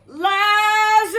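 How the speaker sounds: loud high sung call; calling Lazarus out of the tomb; calling loudly. A narrator's reading. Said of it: "Then Jesus called loudly".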